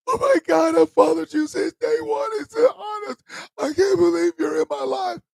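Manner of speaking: very high, loud, tearful fan voice; high, loud, weeping mock fan; imitating a sobbing fan. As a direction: gush through tears like an overwhelmed fan. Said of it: "he was in tears. He was crying".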